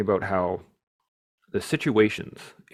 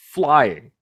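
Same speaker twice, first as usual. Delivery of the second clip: normal talking voice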